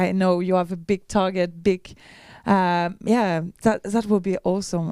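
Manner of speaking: usual speaking voice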